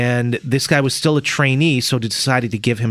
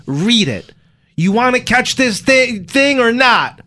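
acted order; 11 semitones higher, slower than usual. Acted lawman ordering decoy.

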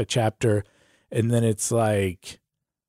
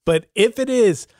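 ordinary talk, nothing marked

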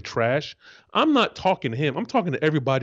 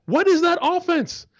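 incredulous outburst; 14 semitones higher than usual. Real, not acted. Mocking Gruden's offense.

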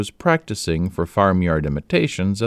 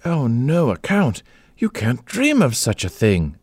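exclaiming in alarm; 7 semitones higher than usual. A narrator's reading. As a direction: protest in a flustered rush. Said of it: "exclaimed Amanda".

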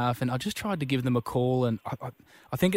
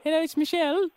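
very high put-on woman's voice; badly faked wife on the phone; Michelle; 13 semitones higher than usual. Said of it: "Doesn't sound like Michelle".

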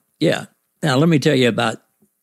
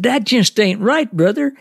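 put-on Southern accent; 11 semitones higher than usual. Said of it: "he has a real Southern accent".

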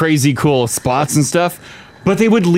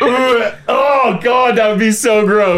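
disgusted; 6 semitones higher, slower than usual. Real, not acted. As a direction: groan it out in horror. Reacting to eaten moth.

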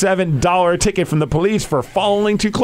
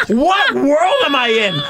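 disbelief; 10 semitones higher, slower than usual. Retold or imitated. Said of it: "I'm like".

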